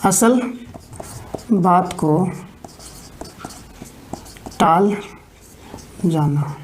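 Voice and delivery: word by word with long gaps, for students to write; slow dictation. Shown as a demonstration. Teacher dictating असल बात को टाल जाना word by word. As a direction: spell out each word slowly. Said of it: "तो यहाँ पे हिंदी में लिख लीजिएगा".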